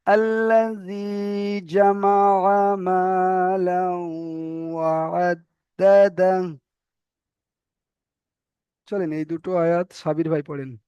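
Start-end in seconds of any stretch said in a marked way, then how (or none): 0.1-6.6 very slow chanted আল্লাযী জামা মালাউ ওয়া আদ্দাদা; recitation demo. Shown as a demonstration. Teacher reciting the verse for students to copy, very slowly. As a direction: draw out each word as in recitation. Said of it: "তাহলে কি হবে?".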